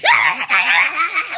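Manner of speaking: high, loud mock yapping shriek; imitated screech of a furious little dog; frantic hammed-up yapping; yapping chihuahua screech. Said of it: "chihuahua, it felt like, was just like".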